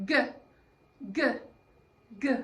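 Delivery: hard "g" as a short "guh"; three separate punched "g" sounds, not the letter name; letter-sound demo. Shown as a demonstration. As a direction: say "g" as in gorilla, cut short. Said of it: "He says".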